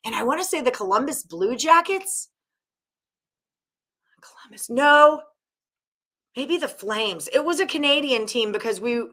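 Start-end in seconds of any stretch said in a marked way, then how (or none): 4.2-5.2 voiceless self-correction; whispered aside. Real, not acted. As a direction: mutter it under your breath. Correcting himself mid-story.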